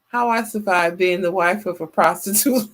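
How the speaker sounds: ordinary talk, nothing marked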